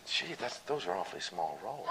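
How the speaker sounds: retold dismay, hushed and laughing; hushed disbelief. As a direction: breathe it out in stunned dismay. Retold reply to stage manager.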